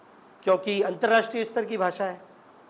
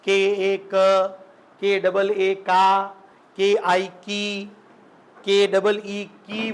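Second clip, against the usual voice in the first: each letter spelt out very slowly, as for a class; slow sounding out; much slower than usual. Shown as a demonstration. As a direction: drag out every letter and syllable like reciting a primer. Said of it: "आपको ऐसी बारहगढ़ी सिखाते हैं". (बहुत धीरे, एक-एक अक्षर अलग बोलकर)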